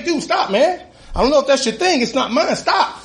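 high indignant stop; retold protest. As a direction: push back at the cop, voice climbing. Protesting while cop pulls pants.

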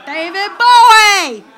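loud, slow, drawn-out shout of the name. Retold or imitated. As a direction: bellow it, stretched out. Construction workers calling out the name.

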